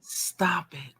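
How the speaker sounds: whispered stop it. Acted. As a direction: breathe it out voiceless. Whispered aside.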